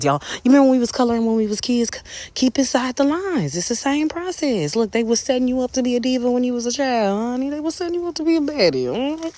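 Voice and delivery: high, playful diva voice; sassy diva. Pretend. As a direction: hype the viewers up like a sassy diva. Sassy diva bit to viewers.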